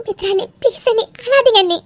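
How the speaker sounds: high, pleading voice; pleading consolation. Acted. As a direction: beg her to stop crying. Pleading again with crying sister-in-law.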